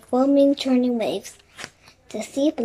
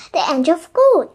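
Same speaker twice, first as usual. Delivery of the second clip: raised, louder exclamation; storybook shout, higher and louder; excited shout; 4 semitones higher, 6 dB louder than usual. A narrator's reading. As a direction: call out a sudden sighting. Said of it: "shouted Jack".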